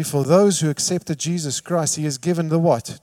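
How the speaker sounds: ordinary delivery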